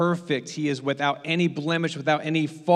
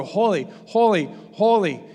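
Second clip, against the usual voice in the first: seraphim cry; 5 semitones higher, 5 dB louder than usual. Acted. Seraphim singing in Hebrew.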